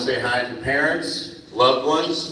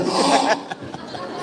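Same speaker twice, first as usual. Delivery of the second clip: mock gasp; 9 semitones higher than usual. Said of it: "I go".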